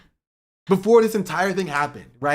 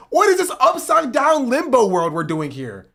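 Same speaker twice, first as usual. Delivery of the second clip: loud, much higher exasperated yelling; exasperated outburst; 8 semitones higher, 4 dB louder than usual. Real, not acted. Streamer yelling at Plattner defenders in chat, exasperated. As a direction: throw up your hands and yell at them.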